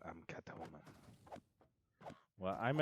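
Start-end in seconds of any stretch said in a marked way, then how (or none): 0.1-0.8 playful murmur. Pretend. Playful aside, soft odd voice.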